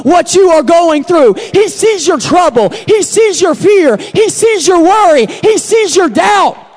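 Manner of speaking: loud, slow, ringing refrain; loud slow preacher refrain; preaching at full force. Acted. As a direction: proclaim each line to the congregation.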